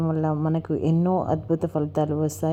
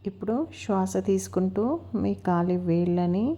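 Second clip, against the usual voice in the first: guided meditation. Acted. Instructor leading listeners to relax each body part, unhurried guided meditation. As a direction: guide them gently through breath and body, stretching key words.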